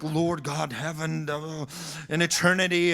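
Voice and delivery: mock lofty prayer. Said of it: "and, and big words".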